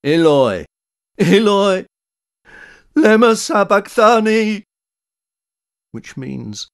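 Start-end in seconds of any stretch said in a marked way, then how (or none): 0.0-4.6 loud, high cry rising through the line; anguished shout. A narrator's reading. Jesus crying out to God as he dies, shouted. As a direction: cry it out at full voice, climbing higher. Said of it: "Jesus shouted".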